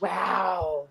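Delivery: awed gasp. Retold or imitated. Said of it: "they would be like".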